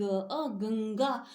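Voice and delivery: गंगा said slowly, the ng pushed through the nose; slow nasal demo. Shown as a demonstration. Teacher slowly sounding गंगा for the class, nasal ng stretched. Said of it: "हवा केवल नाक से निकलती है".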